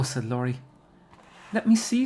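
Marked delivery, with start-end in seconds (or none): 1.5-2.0 high, loud, eager demand. A narrator's reading. Amy wanting to see the heart, eager. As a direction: clamour for a look. Classmates clamour to see gift.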